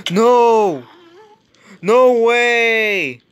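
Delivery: high, loud cries of disbelief; alarmed shouting. Real, not acted. Player hit by a sudden setback, alarmed shouting. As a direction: yell in protest, voice shooting up.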